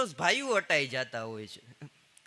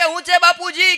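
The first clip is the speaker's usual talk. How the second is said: retold shout; 11 semitones higher, 14 dB louder than usual. Boy answering old man's shout.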